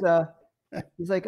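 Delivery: ordinary talk, nothing marked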